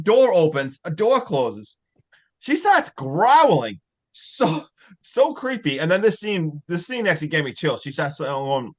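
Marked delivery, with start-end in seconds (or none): none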